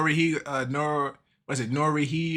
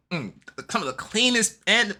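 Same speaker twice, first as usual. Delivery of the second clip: ordinary delivery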